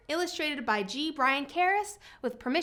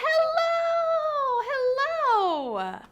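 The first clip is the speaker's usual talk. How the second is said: loud hello; 14 semitones higher, 4 dB louder, much slower than usual. Shown as a demonstration. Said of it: "as loud as we can".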